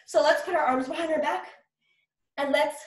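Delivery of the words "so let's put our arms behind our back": ordinary delivery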